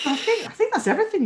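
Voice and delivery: high, quick, through laughter; laughing. Real, not acted. Host trying to wrap up after laughing, words broken by laughter. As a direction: still giggling as you speak. Getting over a long shared laugh.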